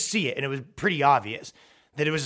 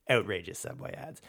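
ordinary talk

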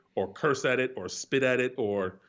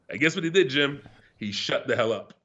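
ordinary talk, nothing marked

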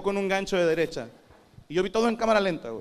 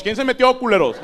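loud, furious shout at the corner; angry shout; 7 semitones higher, 8 dB louder than usual. Retold or imitated. Boxer yelling at his coach about a cheap shot, furious shout. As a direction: bellow it like someone sucker-punched you. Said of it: "Volteé con mi coach".

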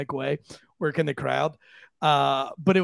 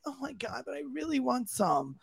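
high, much softer whine; whiny plea; 7 semitones higher, 9 dB quieter than usual. Retold or imitated. Girl begging comedian for cocaine, high and much softer. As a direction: beg for it in a small high whine. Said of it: "She's like".

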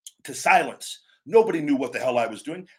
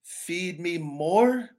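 slow, drawn-out half-hearted delivery; weak catchphrase; 4 semitones higher, much slower than usual. Shown as a demonstration. Wrestler showing a limp catchphrase, drawn out. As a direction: say it like you don't believe it. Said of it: "Do you think if I'm going".

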